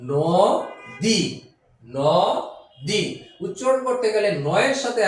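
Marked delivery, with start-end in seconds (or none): 0.1-3.0 নদী drawn out very slowly, syllable by syllable; slow syllable split. Shown as a demonstration. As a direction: stretch নদী into its two syllables. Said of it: "যদি আমরা বলি". (নদী ধীরে ধীরে ভেঙে বলা)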